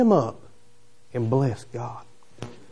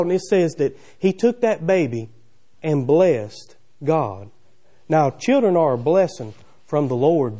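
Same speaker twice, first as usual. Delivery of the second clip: ordinary delivery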